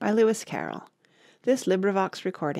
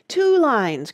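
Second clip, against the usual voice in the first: higher, louder exclamation; excited cry; 8 semitones higher, 7 dB louder than usual. A narrator's reading. Mock Turtle correcting the Gryphon's dance steps, crying out. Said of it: "cried the Mock Turtle".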